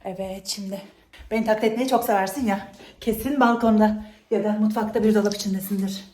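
ordinary talk, nothing marked

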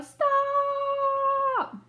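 put-on long high Stop! held out to the kids; high called stop. Shown as a demonstration. Teacher leads the class in yelling stop. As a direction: call it out for everyone to join. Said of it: "Everybody yell".

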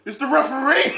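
loud, high exclamation into laughter; delighted shout. Real, not acted. Streamer spotting the referee in disguise, laughing shout. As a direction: crack up at the reveal.

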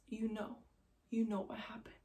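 very soft, near-whispered; hushed retold accusation. Husband's mom looks at the wife and answers, hushed. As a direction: let it fade to a whisper, pausing midway.

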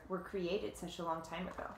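usual speaking voice

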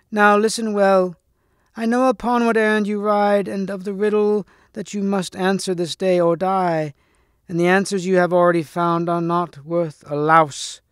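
high, cracked hag voice; loathly lady's high, insistent voice; shrill cracked lady. A narrator's reading. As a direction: pitch it up like a shrill crone. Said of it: "in a shrill, cracked voice".

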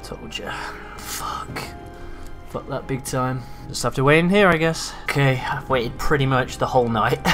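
ordinary delivery

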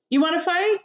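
high, pushy taunting challenge; challenging. A narrator's reading. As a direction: taunt them into a fight. Grouchy ladybug challenges.

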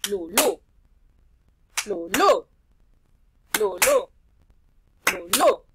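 "Lulú" chopped loud into LU-LÚ; syllables split. Shown as a demonstration. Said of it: "Voy a separar en sílabas esa palabra". ("Lulú" separado en sílabas)

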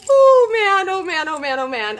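loud high cry fading down; overwhelmed gushing. Real, not acted. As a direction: gush then calm yourself.

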